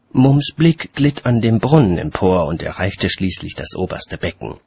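plain reading voice

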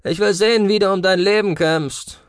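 high, loud taunt; called out. A narrator's reading. Cassian challenges Marv to fight for life, shouting. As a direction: raise the voice in a challenge. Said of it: "rief er".

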